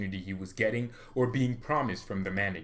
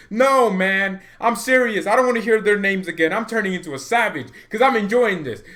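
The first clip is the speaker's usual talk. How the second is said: loud rant; 7 semitones higher, 7 dB louder than usual. Real, not acted. A fan ranting at his commenters, loud and high. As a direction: vent at the comment section, full volume. Ranting at fans about signings.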